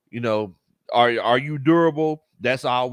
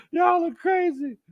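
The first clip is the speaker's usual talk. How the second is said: high, laughing and slow. Real, not acted. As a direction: laugh it out at the chat. Laughing at chat jokes.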